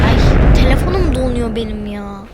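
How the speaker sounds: loud frustrated outburst; annoyed loud cry; loud complaint. Real, not acted. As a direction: yell it at the frozen phone. Phone freezing, loud complaint.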